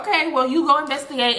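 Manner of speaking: ordinary talk, nothing marked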